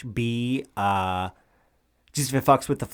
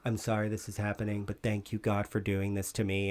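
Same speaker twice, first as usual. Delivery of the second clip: hushed inner monologue, much softer; inner thoughts; 4 semitones lower, 11 dB quieter than usual. Retold or imitated. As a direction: murmur it like a private guilty thought. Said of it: "he's having his thoughts like".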